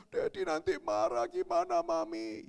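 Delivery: put-on high, wailing little kid; mock childish sobbing worry; imitated crying child. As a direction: fret tearfully to mother.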